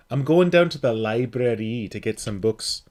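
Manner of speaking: a light put-on Geordie accent, stretching "library"; mock Geordie accent. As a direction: casually imitate a Newcastle Geordie speaker. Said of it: "I suck at my Geordie accent".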